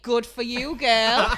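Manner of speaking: loud, high sassy cheer with a laugh; laughing mock cheer; sassy catchphrase. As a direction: cheer him on, camp and laughing. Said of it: "If he sends a fit selfie".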